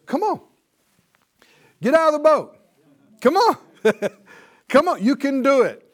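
high, loud, rousing encouragement; calling us. Acted. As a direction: urge them out with eager shouts. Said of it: "The Lord is calling us".

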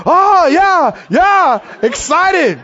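high, loud, hammed-up eagerness; startled mock enthusiasm. Startled students answering the teacher, hammed-up excited shout. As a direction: imitate students jolted into cheering. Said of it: "We thought".

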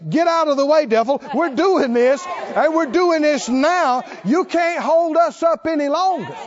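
high, forceful rebuke of the devil; bold, emphatic defiance; defiant preaching. Acted. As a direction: drive it home like a rallying preacher. Acts out defiant words to devil.

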